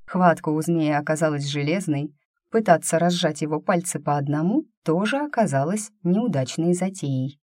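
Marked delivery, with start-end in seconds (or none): none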